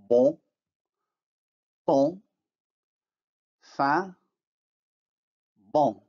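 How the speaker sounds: bon, ton, fin, bon with nasalized vowels; French nasal vowels said clearly for the class. Shown as a demonstration. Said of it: "as in the words".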